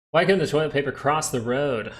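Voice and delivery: ordinary talk, nothing marked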